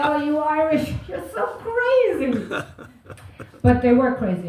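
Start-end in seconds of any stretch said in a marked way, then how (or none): 0.1-2.3 high, swooping camp gush; mock camp flatmate. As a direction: gush at the Irish girl like she's adorable. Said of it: "He was like".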